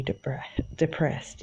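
whisper. Real, not acted.

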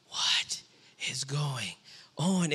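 bewildered whisper; hushed, stunned question. Retold or imitated. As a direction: breathe it out in disbelief. Dad baffled by crying daughter.

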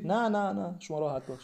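sheepish denial. Acted. Denies after muttered curse.

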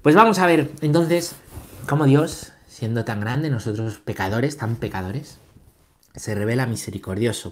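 ordinary talk, nothing marked

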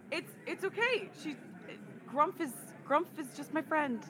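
ordinary talk

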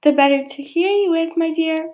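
high-pitched wolf playing granny; high, put-on old granny reply; fake granny voice. A narrator's reading. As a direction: sweet-talk in a high granny disguise. Wolf replies posing as granny.

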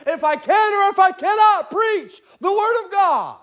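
climbing, high, full-force preaching; rising preacher cadence. Acted. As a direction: build the sermon to a peak.

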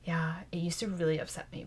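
whispering. Real, not acted. Confessing hurt, hushed.

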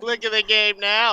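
mock clueless Bob. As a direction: playfully nag Matt to keep watching. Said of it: "I love that rendition".

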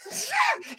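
high, bursting laugh; burst of laughter. Real, not acted. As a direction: laugh out at the punchline. Laughing at coach's punchline.

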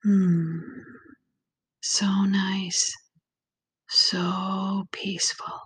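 calm, unhurried visualization voice with long pauses; guided meditation. Acted. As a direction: ease the listener gently into relaxation, lingering on key words.

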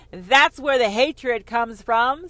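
slow, loud declaration; loud proclamation. Acted. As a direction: boom it out like a decree. Repeated line, now loud and slow.

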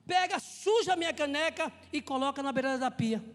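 loud, insistent domestic command; retold yelling. Husband back from a trip yelling at his wife. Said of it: "você vai gritar comigo".

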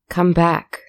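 no longer coaxing, press her; impatient demand, edged with irritation; firm command. A narrator's reading. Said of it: "not quite beckoning this time".

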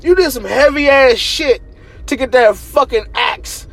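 high and loud indignation; real incredulous outrage; heated rant. Real, not acted. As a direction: demand an answer, loud. Heated rant about Thor.

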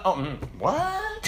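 slow "mm", rising to high-pitched "what"; mock disbelief. Kid hearing servant offered as a career, incredulous. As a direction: recoil in baffled, hammed-up surprise.

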